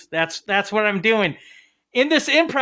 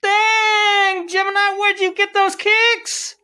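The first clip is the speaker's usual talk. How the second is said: hip shoe guy; 9 semitones higher, slower than usual. Acted. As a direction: gush over the sneakers like a slick party guest. Plays the shoe-complimenting guest.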